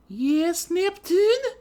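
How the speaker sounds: very high, loud, slow prissy voice; haughty heiress greeting. A narrator's reading. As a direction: greet her rival from on high. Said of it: "Weiss asked innocently".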